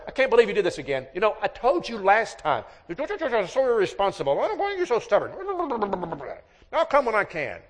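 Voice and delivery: high, loud, scolding rant; the wrong way to answer your wife, a high angry scold; angry husband. Retold or imitated. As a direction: chew her out, exasperated and loud. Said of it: "you could say to her".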